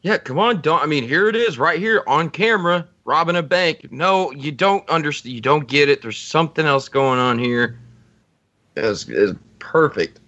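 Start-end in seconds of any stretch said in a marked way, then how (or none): 0.0-7.8 imitated exasperated back-and-forth, high-pitched; insistent arguing. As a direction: argue the case in a raised pitch. Voices the argument with sceptical cop Don.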